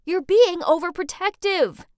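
loud, high argumentative outburst; shouting back. A narrator's reading. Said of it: "I shouted back".

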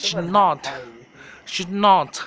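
loud "schnodd" as a made-up English word for pajamas, with a short clipped ending; mock English pronunciation. Shown as a demonstration. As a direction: shout "schnodd" like a teacher drilling a class. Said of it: "ออกเสียงพร้อมกันนะคะ". (ตะโกนคำว่า "schnodd" เหมือนครูสอนออกเสียง)

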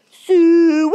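loud, high, drawn-out holler; hog call. A narrator's reading. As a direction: call the hogs across the fair. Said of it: "But when he called".

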